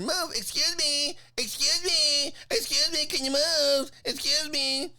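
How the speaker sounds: high, drawn-out mock nagging; nasty heckler voice. Woman behind demanding he move, nagging high voice. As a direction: pester in a high caricature of a woman. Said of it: "ugly, ugly voice".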